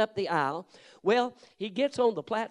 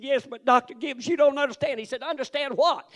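urgent pleading; 8 semitones higher, 5 dB louder than usual. Retold or imitated. Pastor pleading over interruptions.

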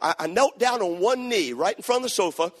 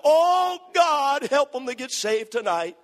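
a loud, high, sobbing shout that fades; retold crying prayer; 6 semitones higher, 3 dB louder, slower than usual. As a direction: wail it out to God, then plead. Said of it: "He's bawling his eyes out".

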